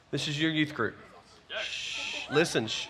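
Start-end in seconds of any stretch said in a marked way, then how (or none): 1.5-2.3 soft unvoiced shh; shushing. Real, not acted. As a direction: hush the room. Quieting a crowd of students.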